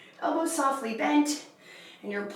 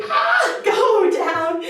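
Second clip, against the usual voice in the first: laughing; 6 semitones higher, 7 dB louder, much slower than usual. Real, not acted. She misreads the exercise, laughs.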